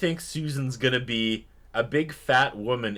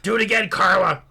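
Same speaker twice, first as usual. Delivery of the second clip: louder, higher hammed-up shout; imitating a bossy director calling out; mock director yell; 8 semitones higher, 7 dB louder than usual. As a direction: yell the order across the set. Imitating director yelling at actress.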